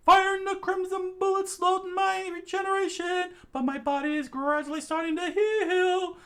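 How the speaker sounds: high voice, loud reassurance; Andy calling out. Acted. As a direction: call out to everyone. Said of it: "he screamed out".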